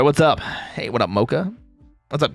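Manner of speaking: ordinary talk, nothing marked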